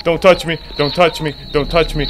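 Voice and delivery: high, loud frantic begging; panicked player begging a monster; panicked pleading. Real, not acted. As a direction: beg the monster to stay away.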